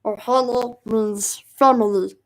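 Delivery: slow, loud cartoon alien creature impression; Stitch. Shown as a demonstration. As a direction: drag the words out as a cartoon creature. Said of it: "I can do Stitch".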